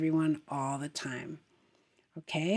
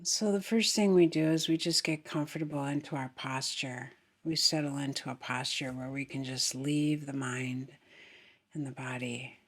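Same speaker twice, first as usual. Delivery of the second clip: soft, unhurried meditation voice; guided meditation; 2 semitones lower, 4 dB quieter than usual. Acted. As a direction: guide them gently into the breath.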